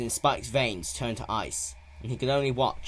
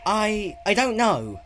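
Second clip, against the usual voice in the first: put-on young dragon voice; 12 semitones higher, 9 dB louder, slower than usual. A narrator's reading. Spike answers Twilight, confused.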